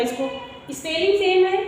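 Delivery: ordinary talk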